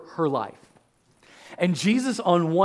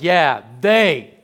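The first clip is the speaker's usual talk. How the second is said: knowing they; 2 semitones lower, 6 dB louder than usual. Retold or imitated. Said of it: "they're like".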